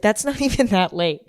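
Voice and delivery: ordinary delivery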